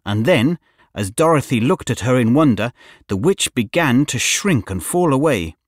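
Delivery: ordinary delivery, nothing marked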